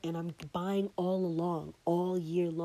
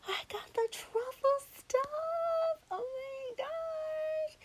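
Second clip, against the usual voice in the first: squealing much higher, slow and delighted; thrilled inner voice; much slower than usual. Retold or imitated. Finding the truffle gift in the drawer, delighted squeal. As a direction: let it rise into a giddy squeal of discovery. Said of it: "something in me is like".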